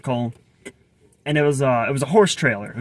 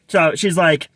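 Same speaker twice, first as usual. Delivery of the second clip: usual speaking voice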